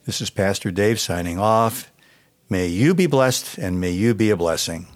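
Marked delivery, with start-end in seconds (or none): none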